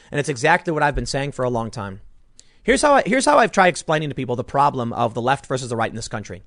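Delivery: ordinary talk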